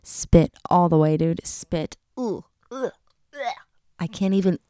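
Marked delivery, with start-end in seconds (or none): 2.2-3.5 short, soft revolted "ugh" noises; disgusted groans. Real, not acted. Woman grossed out by the thought of swallowing, disgusted groans. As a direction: recoil with little grossed-out groans.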